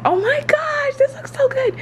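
high, delighted; excited. Real, not acted. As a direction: squeal at the food. Seeing her food.

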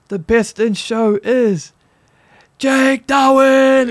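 slow, high, loud drawn-out announcement; announcer reveal. Retold or imitated. Host announcing best in show, slow dramatic reveal. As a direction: stretch the winner's name like a host. Announcer voiced naming the winner.

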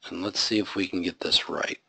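whispered to himself while typing; hushed self-talk under the breath. Real, not acted. Teacher mutters to self while coding.

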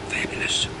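breathy whispered praise. Real, not acted. Savoring the burger for viewers, whispered. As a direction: whisper it like a secret delight.

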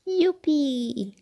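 high, gleeful cheer; high-pitched little whoop of joy; joyful cry. A narrator's reading. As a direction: cry out in delight. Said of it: "s'écria-t-il".

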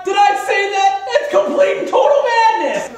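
excited shout. Acted. Parody infomercial hype shouting.

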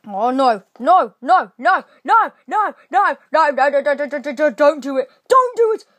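panicked. Real, not acted. Being shot at in battle.

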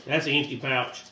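usual speaking voice

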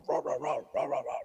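quick mock dog barks; spoken dog-bark impersonation; imitated barking. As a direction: bark in words like an alarmed dog.

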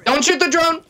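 high, loud warning yell; urgent shout. Real, not acted. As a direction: shout it to stop him. Yelling at teammate mid-round.

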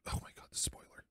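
breathy whisper of dismay. Real, not acted. Gamer aghast at the spoiler, whispering. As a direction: whisper it in disgust. Horrified aside at ugly spoiler.

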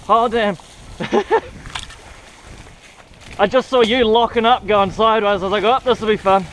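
normal talking voice

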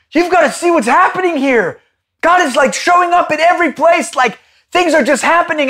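high, loud and thrilled; excited call. Retold or imitated. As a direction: burst out with the news.

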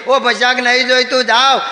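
frantic refusal. Retold or imitated. Saint fleeing, refusing loudly.

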